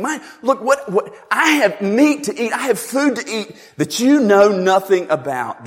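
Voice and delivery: high and loud, urgent insistence; fervent Jesus. Retold or imitated. Jesus insisting to disciples about his food, high and loud.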